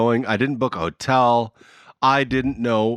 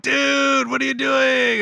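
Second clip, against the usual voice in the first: high, shouted mock greeting; calling out; 13 semitones higher, slower than usual. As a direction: yell it across to a friend. Friends calling out to him.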